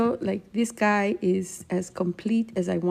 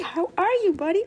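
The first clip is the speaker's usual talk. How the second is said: high, sweet voice greeting a little painting; talking sweetly to her finished painting; cute baby talk; 10 semitones higher than usual. Pretend. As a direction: coo at the painting.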